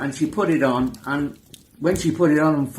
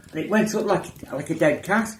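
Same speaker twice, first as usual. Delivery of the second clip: normal talking voice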